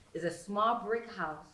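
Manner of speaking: ordinary delivery, nothing marked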